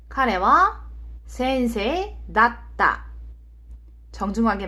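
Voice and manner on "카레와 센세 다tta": slow, word-by-word Japanese example; careful teacher's demonstration, drawn out; slow model sentence. Shown as a demonstration. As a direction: model it slowly for learners, phrase by phrase.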